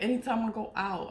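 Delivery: normal talking voice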